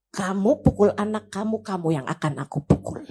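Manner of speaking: low, grave warning; Nabi Muhammad. Retold or imitated. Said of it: "Nabi Muhammad bakal bilang begini". (peringatan rendah dan khidmat)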